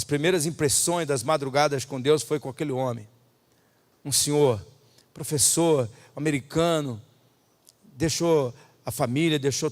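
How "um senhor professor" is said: usual speaking voice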